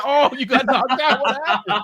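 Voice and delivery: laughing mockery over the beaten foe; lightly acted Inquisitor crowing; gloating hype man. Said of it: "He walked in and said".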